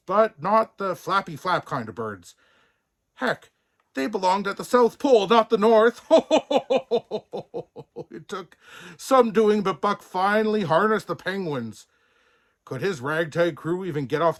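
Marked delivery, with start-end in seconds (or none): 6.1-8.0 high, bright burst of laughter; amused laugh. Real, not acted. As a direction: laugh at the joke. Narrator laughs at penguins.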